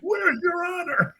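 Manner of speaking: high, slow mock shout; outraged father. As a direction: play a scandalized dad, stretching each word up high. Father scolding son.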